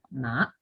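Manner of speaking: "ma" soft, rising like a question; second tone. Shown as a demonstration. Teacher confirming the second tone for students, rising "ma". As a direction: glide "ma" lightly upward. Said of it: "Ese es el segundo tono".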